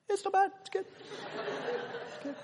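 choked, breathy half-laugh through tears; holding back tears. Retold or imitated. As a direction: fight the tears as you praise the speech. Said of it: "holding back the tears".

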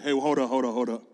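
low, soft demon holding them off. Retold or imitated. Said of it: "the demon's like".

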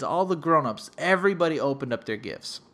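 ordinary delivery

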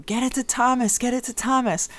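a mild mock yell from the bench; sideline yelling. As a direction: call it out across the court to a player. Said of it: "I was yelling".

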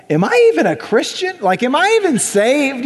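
high-pitched mock self-questioning; acted self-doubt. Acted self-doubt on hearing great prayer.

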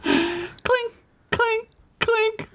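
high, ringing clink sounds imitated; clinking pickaxe. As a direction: mimic a pickaxe striking stone.

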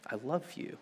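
soft, hushed "I love you"; father hushing love to his newborn; tender whisper to newborn. Retold or imitated. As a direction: murmur it to the baby on your chest. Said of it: "telling her".

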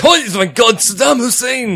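high, loud, drawn-out mock shout; hammed-up cry of astonishment; mock shock. Recruit suddenly seeing Saddam Hussein, mock-shocked shout. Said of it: "He's like".

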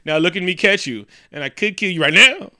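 loud, high, rising taunts; mock villain taunting. Villain gloating over captured hero, hammed-up taunt.

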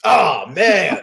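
real frustration, shouted out; dismayed shout. Real, not acted. Losing the point in quiz.

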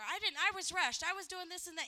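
hammed-up rushed excuse, pushed loud; defensive outburst. Wife defending a rushed dinner, mock upset and loud. Acted kitchen quarrel.

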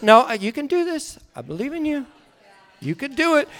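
soft, tender encouragement; gentle reassurance. Retold or imitated. Heavenly Father answering panicked child, gently reassuring. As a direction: settle them quietly with belief.

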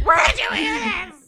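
high, loud retold shriek; mock outraged mother. Mom appalled at child's swearing, mock outraged shout. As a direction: cry it out as an appalled parent.

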